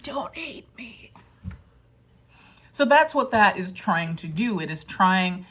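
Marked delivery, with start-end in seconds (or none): 0.0-1.1 tiny pleading whisper, higher. Retold or imitated. Imagined plea to an upset client, whispered. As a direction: beg in a small whispered voice.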